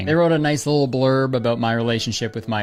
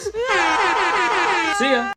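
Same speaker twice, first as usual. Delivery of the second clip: very high, shouted see-ya; cheesy vlog outro. Pretend. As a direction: toss off a big bright goodbye.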